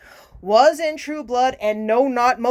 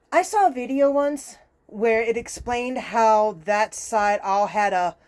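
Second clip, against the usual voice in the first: ordinary talk, nothing marked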